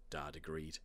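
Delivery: ordinary delivery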